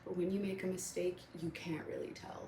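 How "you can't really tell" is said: whispered aside. Real, not acted. Quiet aside on mistakes.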